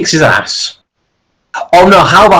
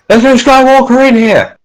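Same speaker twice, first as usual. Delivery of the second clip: higher-pitched trooper reporting back; searching trooper; 10 semitones higher, 4 dB louder than usual. Acted. Troops report an empty ship, higher-pitched trooper voice. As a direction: call out like a trooper mid-search. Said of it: "they're searching the ship and they say".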